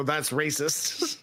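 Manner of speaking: very slow, flat deadpan. Retold or imitated. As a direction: drag it out, unimpressed. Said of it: "Colin go".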